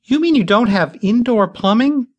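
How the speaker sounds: put-on high, appalled young woman; high appalled question; shocked. A narrator's reading. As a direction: pitch up in disbelief. Bitsy shocked.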